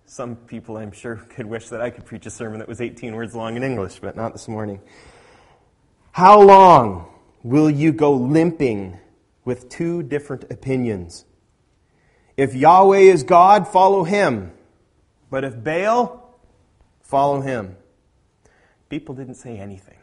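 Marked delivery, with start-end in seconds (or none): 6.1-17.8 loud, slow preached call to choose; booming prophet's challenge; preaching at full force. Acted. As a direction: thunder it out like a prophet. Preacher voicing Elijah's sermon.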